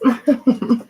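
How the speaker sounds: a quick real laugh at herself; amused little laugh; short laugh. Real, not acted. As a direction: laugh it off briefly. Laughs after internet story.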